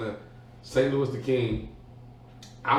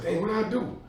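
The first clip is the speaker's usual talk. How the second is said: puzzled; 8 semitones higher than usual. Retold or imitated. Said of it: "I said".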